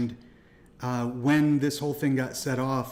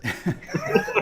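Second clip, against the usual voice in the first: burst of laughter. Real, not acted.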